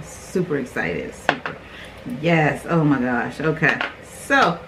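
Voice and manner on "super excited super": soft, low-pitched excitement; low hushed excitement. Real, not acted.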